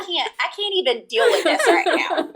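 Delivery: high, laughing mock protest; retold overwhelm. Grieving wife handing decision to husband, mock overwhelmed protest. Retelling her overwhelmed refusal.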